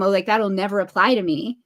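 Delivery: ordinary talk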